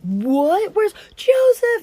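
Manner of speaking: high, slow stunned disbelief; hammed-up panic; shocked. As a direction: drag out the shock as you find him gone. Reuben finds pit empty.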